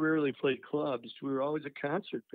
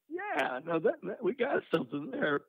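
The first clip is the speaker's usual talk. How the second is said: excited yeah; 8 semitones higher than usual. Retold or imitated. Finding the riff.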